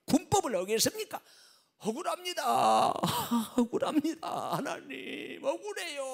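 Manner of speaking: high, loud wailing complaint to God; wailing prayer. Acted. General wailing to God over his lost stars: anguished wail. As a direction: cry out the injustice to God. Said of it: "통곡을 하면서".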